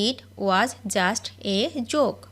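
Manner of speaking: very slow, word by word; each word drawn out for learners to copy; slow dictation. Shown as a demonstration. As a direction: spell it out slowly for the class. Repeated slowly for learners.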